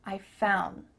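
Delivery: low "found" as "foun"; ends on N. Shown as a demonstration. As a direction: end "found" on n, no d. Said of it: "The word ends in the letter N".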